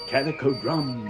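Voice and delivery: usual speaking voice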